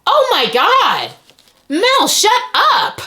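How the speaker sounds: high, slow, swooping disbelief; stunned delight. Real, not acted. Vlogger stunned by binder Mel's work, delighted disbelief. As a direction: stretch each exclamation out in amazement.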